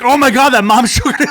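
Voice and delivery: high, loud scandalised gasp, laughing through; shocked older women. Retold or imitated. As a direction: play the horrified onlookers. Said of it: "they were like".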